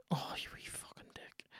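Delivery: breathy whispered curse at the screen; whispered insult. Real, not acted. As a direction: hiss it under your breath. Muttering insult at the game.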